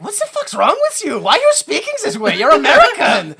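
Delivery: high, loud mock-child scolding; indignant German kids. German children yelling at the villain, shrill. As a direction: scold him like outraged little kids. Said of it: "German children were like".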